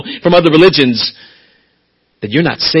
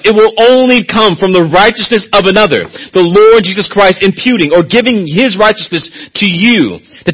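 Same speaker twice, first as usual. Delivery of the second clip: higher, louder, driving sermon delivery; fervent preaching; 8 semitones higher, 4 dB louder than usual. Acted. Preacher pressing the congregation about grace, fervent and loud. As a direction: preach it out with rising fire.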